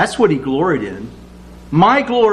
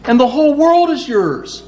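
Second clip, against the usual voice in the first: higher, eager proclaiming; a hint of Paul's excitement; 9 semitones higher than usual. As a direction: tell them the whole world is theirs. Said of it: "And he says".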